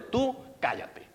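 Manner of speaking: put-on high, slow bossy child voice. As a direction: order mom to shut up like a little tyrant. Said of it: "Y estoy hablando así".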